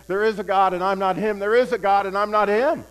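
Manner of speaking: loud, insistent retold cry, repeated; hammed-up overwhelmed wail of faith; imitating tearful outpouring. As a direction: belt it out like someone pouring tears. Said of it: "And he's pouring".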